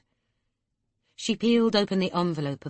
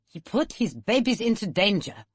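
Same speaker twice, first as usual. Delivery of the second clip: put-on strong German accent, gasped out, a touch higher; 4 semitones higher than usual. A narrator's reading. Chris's mother condemning him in a strong German accent. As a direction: gasp it out in a heavy German accent. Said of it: "she'd gasped in her strong German accent".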